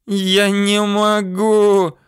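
put-on high, drawn-out girl's wail; long high-pitched howl of helplessness. A narrator's reading. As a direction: howl it high and slow, in despair. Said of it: "вдруг завыла девочка".

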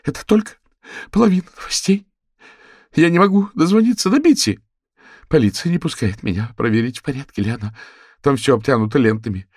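high, loud sobbing panic; cracking tearful heartbreak. Acted. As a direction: plead through tears, voice cracking. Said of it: "всхлипывая на каждом слове".

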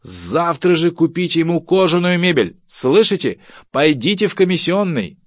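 high, loud bossy command; imperious order. Acted. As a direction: order a subordinate off to buy it. Boss loudly orders staff about. (громкий начальственный приказ)

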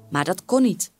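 usual speaking voice

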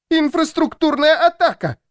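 panicked, very high-pitched cry; high squeal. A narrator's reading. Louisa squeals as the lights go out, panicked. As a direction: squeal it in alarm on one high note. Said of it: "Ещё громче завизжала она".

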